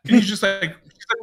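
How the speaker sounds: ordinary delivery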